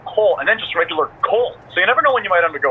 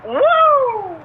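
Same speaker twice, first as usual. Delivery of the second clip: excited wrestling woo. Pretend. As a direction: let out a big woo. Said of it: "once you start wooing".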